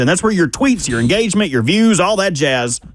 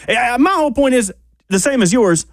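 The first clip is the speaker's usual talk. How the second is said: impatient excited; 9 semitones higher than usual. Real, not acted. Host impatient for the pick.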